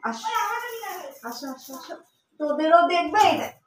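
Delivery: high coaxing call; calling the other pets to look, high and coaxing; coaxing pet talk. Real, not acted. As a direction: coax the pets over sweetly.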